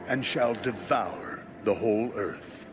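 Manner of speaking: ordinary delivery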